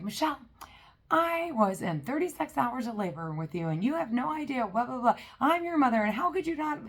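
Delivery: high, pleading mother's voice; high, whiny mock mom pleading; guilt-tripping mom. Mom's guilt trip, voiced.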